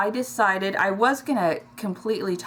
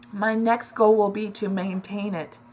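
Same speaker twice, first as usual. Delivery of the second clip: ordinary delivery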